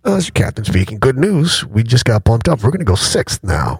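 low, upbeat captain on the intercom; deep airline pilot PA with good news; pilot announcement voice. Retold or imitated. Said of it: "A couple minutes later".

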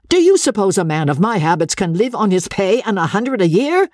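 indignant, high-pitched question; angry outcry. A narrator's reading. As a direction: protest in offended disbelief. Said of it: "George cried out in great anger".

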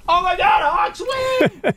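high excited squeal, imitating wife; mock scream. Husband imitating his wife screaming about the Hawks win, excited squeal. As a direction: squeal it like a fan celebrating. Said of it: "She, like, screams".

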